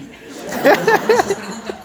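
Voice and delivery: words squeezed out through laughter; laughing. Real, not acted. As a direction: laugh while you say it. Laughing while telling.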